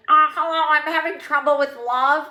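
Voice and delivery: mock caller. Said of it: "No one wants to hear the other person".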